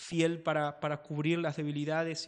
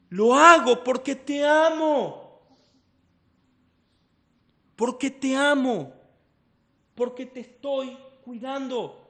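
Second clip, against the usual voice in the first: loving father; 8 semitones higher, 8 dB louder than usual. Retold or imitated. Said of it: "Pato le dice a Sarita".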